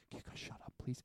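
hushed unvoiced whisper. Friend shushing them in the theater, whispering. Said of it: "Ollie's just like".

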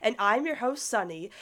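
normal talking voice

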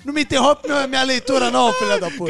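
loud, high, hammed-up rude greeting; rude character. Cohost playing the rude show, loud hammed-up insults. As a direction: insult the host theatrically. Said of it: "A Praça é grossa".

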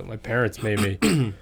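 usual speaking voice

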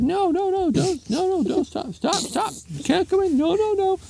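very high falsetto panic; hammed-up woman in a panic; frantic pleading. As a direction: beg it to stop in a rapid-fire squeal. Woman frantically stops elephant entering.

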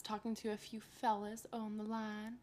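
soft, slow Southern drawl; lightly put-on Southern accent. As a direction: ease into a slow Southern twang. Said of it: "Southern accent's coming out".

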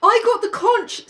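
put-on high boyish Piggy voice, glum; a sulky schoolboy clinging to the conch; high and bleak. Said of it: "said Piggy bleakly".

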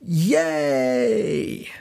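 high, long drawn-out yay; all-caps cheer. Retold or imitated. Dad texting his daughter back in capitals, gleeful cheer. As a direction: let out a gleeful, stretched cheer. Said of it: "in capitals, with exclamation marks".

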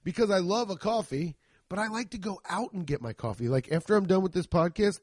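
ordinary talk

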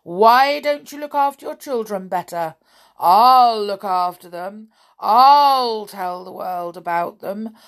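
loud, booming reproach; accusing disembodied voice. A narrator's reading. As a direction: threaten with long, heavy openings. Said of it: "said the voice again".

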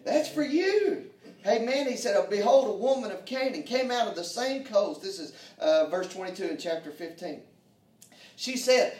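loud, high, ringing preacher's voice; full-force preaching. Acted. Preacher rousing the church at full force.

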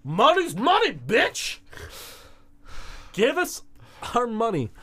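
high-pitched cocky demand; hammed-up high-voiced bravado; Josh demanding cash. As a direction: crow it up high, punching each demand. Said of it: "And Josh is like".

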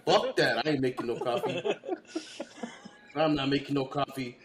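mock lazy coworker refusing; hammed-up grumble of a lazy crewman; lazy refusal. Imitating the lazy crewman refusing to make coffee. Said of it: "the Joey would just be like this".

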